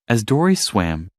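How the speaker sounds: usual speaking voice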